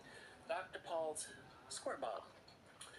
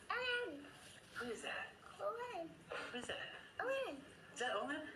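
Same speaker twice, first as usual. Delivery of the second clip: high squeaky name calls; sing-song; 11 semitones higher than usual. Real, not acted. Playful name game with child.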